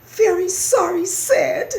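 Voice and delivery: high, loud tearful mouse wail; wailing, sorry little mouse; crying out. A narrator's reading. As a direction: squeak a sobbing apology. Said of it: "cried Christopher".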